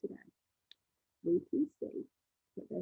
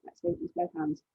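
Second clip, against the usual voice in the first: normal talking voice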